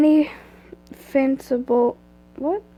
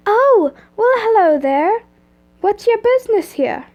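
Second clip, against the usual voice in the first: polite, higher-pitched lightly Scottish receptionist; slight Scottish accent; 8 semitones higher, 4 dB louder than usual. Acted. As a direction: greet a visitor politely with a light Scottish lilt. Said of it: "she asked politely in the slightest Scottish accent".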